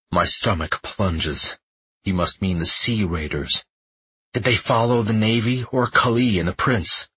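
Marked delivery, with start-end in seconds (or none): none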